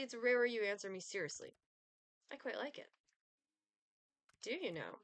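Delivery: usual speaking voice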